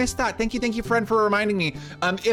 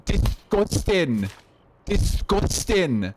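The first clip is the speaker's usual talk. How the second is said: disgusted; much slower than usual. Real, not acted. Disgusted by a straight man's shower.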